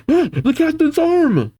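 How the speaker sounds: high-pitched cry of dismay; alarmed call. Acted. Giant seeing his captain maimed, alarmed high cry. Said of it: "One of the giants call out".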